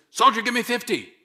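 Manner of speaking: loud high barked drill order; drill sergeant. Pretend. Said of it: "and says".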